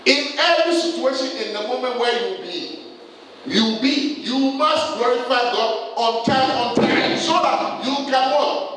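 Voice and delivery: high, urgent sermon exhortation; impassioned preaching. Real, not acted. Preacher urging congregation to glorify God, impassioned preaching. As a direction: drive the command home to the congregation.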